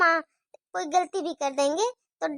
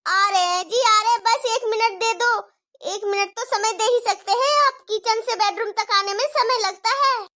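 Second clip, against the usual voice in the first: higher, louder, rushed reply; hurried wife; 6 semitones higher, 5 dB louder than usual. Acted. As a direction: tell him you're on your way. Wife answers husband again. (जल्दी में जवाब देती बहू, ऊँची आवाज़)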